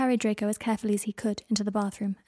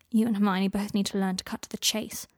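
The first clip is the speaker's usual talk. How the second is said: ordinary delivery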